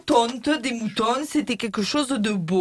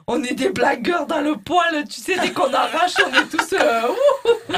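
laughing; 5 semitones higher, 3 dB louder than usual. Acted. Joking about waxing, laughing loudly.

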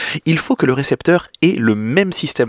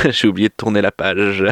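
sheepish laugh through the words; 6 semitones lower than usual. Real, not acted. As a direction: laugh at your own slip.

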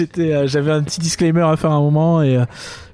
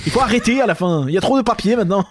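frantic nein nein, sped up; German accent; 3 semitones higher than usual. Acted. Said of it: "pas d'accent allemand problématique". (banquier allemand exaspéré, accent allemand)

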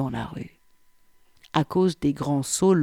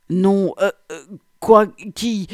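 flustered stammering; 4 semitones higher, 6 dB louder than usual. A narrator's reading. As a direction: stammer loudly, pitch jumping up. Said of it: "bredouillai-je". (bredouillement affolé, plus aigu)

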